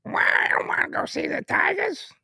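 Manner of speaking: very high, spluttering cartoon duck voice; hammed-up cartoon duck impression; Daffy Duck. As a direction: squawk it like an indignant cartoon duck. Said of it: "that was Daffy Duck".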